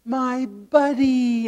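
high, drawn-out happy call; imitated grandchild's yell. Youngest grandson spotting grandpa across the room, imitated delighted yell. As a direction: imitate a child calling out, stretching it slowly. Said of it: "sees me and yells".

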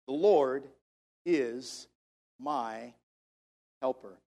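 low, measured, a pause after each word. Shown as a demonstration. Preacher modelling the verse for his congregation, word by word. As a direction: spell out the verse one word at a time.

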